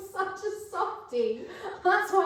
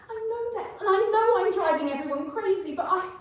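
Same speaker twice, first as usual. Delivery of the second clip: frantic; 5 semitones higher, 4 dB louder, faster than usual. Acted. Frantic high pleading.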